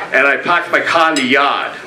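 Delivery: Boston accent, parked and car with no r, yard as yahd; casual middle-class Boston accent, r's dropped. Shown as a demonstration. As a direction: drop every r the Boston way, lightly. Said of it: "that's a middle-class Boston accent".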